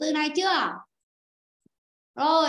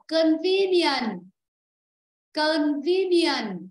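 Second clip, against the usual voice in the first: Convenient very slow and loud, weight on "ve"; stress on second syllable; 4 dB louder, much slower than usual. Shown as a demonstration. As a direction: stretch Convenient and punch the "ve". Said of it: "Rồi âm hai". (Convenient đọc chậm, to, nhấn âm hai "ve")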